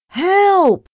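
very high, loud scream; screamed cry for help. A narrator's reading. Narrator about to crash into an iceberg, screaming. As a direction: scream out in alarm. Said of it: "I screamed".

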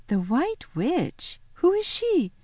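slow echo, then high question; puzzled little girl; Lucy, wondering. A narrator's reading.

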